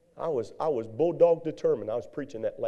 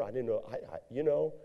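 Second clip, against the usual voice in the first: ordinary delivery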